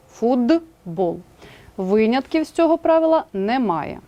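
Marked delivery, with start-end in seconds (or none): 0.1-1.2 Футбол said as "fudbol", voicing the т to д; pronounced with voiced d. Shown as a demonstration. Teacher demonstrating voicing in Футбол. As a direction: say Футбол with a clear д sound, "fudbol".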